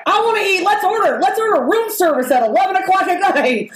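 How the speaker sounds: high, bouncy mock eager friend; imitating a friend who wants room service at night, high and eager; excited friend. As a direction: play the greedy friend begging for a late snack. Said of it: "And she's like".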